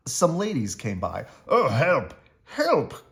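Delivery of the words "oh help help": high, loud cries of help; a pantsless old mouse yelps in panic; panicked cry for help. A narrator's reading. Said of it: "cried the old mouse".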